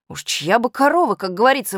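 ordinary delivery, nothing marked

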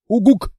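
loud falling hoot; firm hoot. Acted. Monster hoots firm agreement to the girl.

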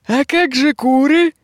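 lightly voiced high, slow mocking witch. The witch teases the prophetess with slow mock concern. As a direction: needle her with a slow sneer. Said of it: "издевалась ведьма".

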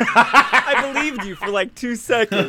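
high, loud words through laughter; laughing. Real, not acted. Teasing a co-host over being fooled, laughing hard. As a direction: talk while cracking up, drawn out.

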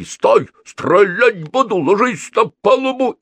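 high, loud shouted commands; yelling. A narrator's reading. Mercenaries practising Russian commands, yelling. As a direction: yell drawn-out orders. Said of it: "Все они орали по-русски".

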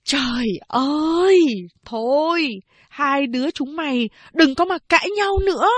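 high, anguished cry to stop the fight; mother crying out. A narrator's reading. Mother crying out to stop her daughters' quarrel. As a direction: wail at them to quit. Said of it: "Mẹ thét to".

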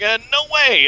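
high, whiny brush-off; whiny kid voice. Retold or imitated. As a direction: squeak it out like a scared kid. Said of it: "he was like".

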